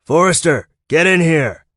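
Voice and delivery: loud, high yell from another room; brother-in-law yelling from the kitchen. Acted. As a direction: shout for him from the kitchen. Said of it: "yelled a voice from the kitchen".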